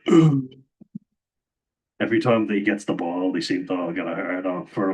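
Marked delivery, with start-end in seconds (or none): 0.1-0.4 high, loud mock moan of delight; mock swooning groan. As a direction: ham up a fan's swooning "ugh". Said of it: "They're always like".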